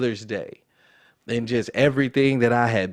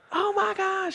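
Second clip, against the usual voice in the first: much higher, squealing mom voice; excited mom. Retold or imitated. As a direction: play an overjoyed mother shrieking hello. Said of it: "she just instantly starts going crazy".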